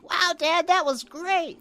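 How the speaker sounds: put-on very high, awestruck little-kid voice; excited kid. Kid to Dad after the movie, excited high voice. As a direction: mimic a thrilled child in a high voice. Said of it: "when I saw that as a kid".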